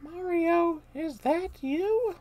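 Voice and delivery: high, slow sweet princess voice; Princess Peach voice. Acted. Player voices Peach greeting Mario, high and sweet. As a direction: raise it up high and gentle.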